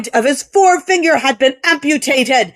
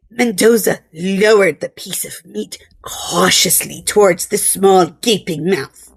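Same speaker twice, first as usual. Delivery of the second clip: ordinary delivery, nothing marked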